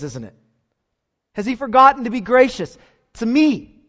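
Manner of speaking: high, loud pulpit reading of psalm; preacher hurling the psalm's questions, loud and high; preaching at full force. Acted. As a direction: thunder the psalmist's questions at the congregation. Reads Psalm seventy-seven aloud.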